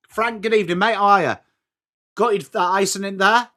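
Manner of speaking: ordinary talk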